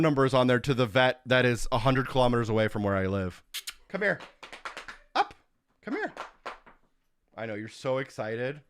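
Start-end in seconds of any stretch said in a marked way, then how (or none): 3.9-5.3 high call to the dog. Real, not acted. Calling dog over, high voice. 5.8-6.1 high dog call. Real, not acted.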